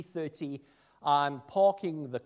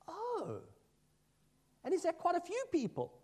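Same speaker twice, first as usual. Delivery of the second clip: much higher, soft, quick imitation; surprised neighbor; 13 semitones higher, 6 dB quieter than usual. Neighbor asking the minister about Sunday, high and amazed. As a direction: play the baffled neighbor, pitched way up.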